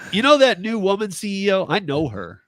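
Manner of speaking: hammed-up bragging to support staff; mock name drop. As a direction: brag like an insider. Joking plan to name drop.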